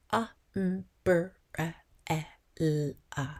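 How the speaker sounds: "A, m, br, a, e, l, a" one isolated sound at a time; each sound of "A, m, br, a, e, l, a" separate, with pauses; sounding out phonemes. Shown as a demonstration. Child skilled in phonics sounds out umbrella, phoneme by phoneme. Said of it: "sound out the individual sounds".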